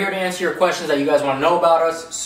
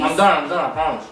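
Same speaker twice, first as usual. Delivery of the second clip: talking while chewing cookies; words round a bite of cookie; mouth full. Real, not acted. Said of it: "talking with his mouth full".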